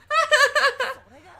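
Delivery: high, loud brittle laugh; forced laugh. Acted. Edena laughing off Kei's accusation, forced high laugh.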